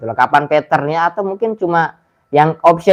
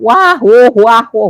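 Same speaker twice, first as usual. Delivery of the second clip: imitating other players yelping, higher and louder; mock scared yelling; 8 semitones higher, 5 dB louder, slower than usual. Mocking how other gamers scream at horror games, loud mock yelps. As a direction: ham up a panicked horror-game player. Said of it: "Orang lain kan main game horror teriak-teriak".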